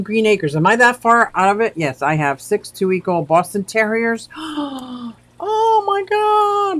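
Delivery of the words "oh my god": high, slow gasp and oh my God; delighted gasp. Real, not acted. Streamer gasping at news of newborn puppies. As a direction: melt over the puppies.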